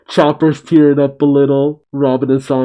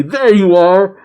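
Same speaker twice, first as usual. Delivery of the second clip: mildly hammed-up angry outcry; raised pitch, a touch of indignation; angry cry; 6 semitones higher, 3 dB louder than usual. A narrator's reading. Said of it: "Momo just cries out angry".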